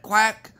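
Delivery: loud, high quack imitation; duck quack. He answers with duck noise.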